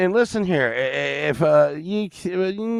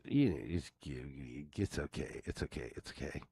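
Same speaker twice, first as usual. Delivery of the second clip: quiet self-reassurance; 12 semitones lower, 18 dB quieter than usual. Real, not acted. Self-soothing murmur.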